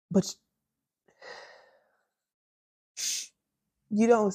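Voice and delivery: choked-up and slowing down, a real catch in the voice; tearful letter to younger self. Real, not acted. Letter to her fourteen-year-old self, emotional and hushed. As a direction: speak it like it almost breaks you. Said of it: "I think I'm emotional".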